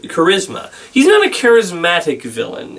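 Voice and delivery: usual speaking voice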